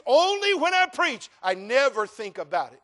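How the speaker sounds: preacher's shouted rebuttal; emphatic reply. Acted. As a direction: insist hard. Answering the challenge.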